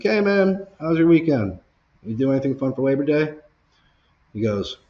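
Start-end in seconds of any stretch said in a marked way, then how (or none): 0.0-3.4 high, loud chirpy retold hello; friendly greeting. Late plumber greeting his manager, friendly. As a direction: greet the boss in a high, breezy voice.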